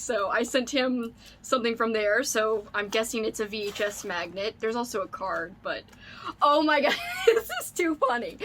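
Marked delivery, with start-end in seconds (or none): 6.4-8.4 high, laughing squeal of disbelief; real burst of laughter. Real, not acted. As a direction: laugh through it, almost shrieking. Realizing they sent the same gift.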